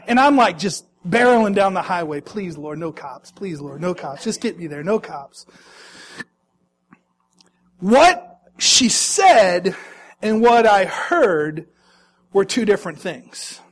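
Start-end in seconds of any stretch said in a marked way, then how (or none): 2.2-5.5 low, much softer pleading prayer; muttered prayer. Retold or imitated. Dad speeding home, praying quietly to God. 7.8-11.7 slow punchline. Shown as a demonstration. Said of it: "punchline delivered slowly".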